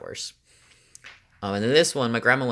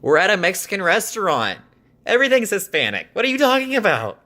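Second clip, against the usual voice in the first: much higher and louder, incredulous; exasperated disbelief; 10 semitones higher, 4 dB louder than usual. Real, not acted. Mocking the boyfriend's Mexican restaurant remark, high and loud disbelief. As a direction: pitch it up high in baffled protest.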